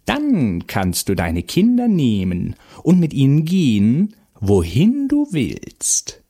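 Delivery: high, swooping witch voice, slowing down; Baba Yaga. A narrator's reading. Baba Yaga sets terms for the mother, imperious witch voice. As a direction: bargain in a screechy, drawn-out witch voice. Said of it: "brüllte die Baba Yaga".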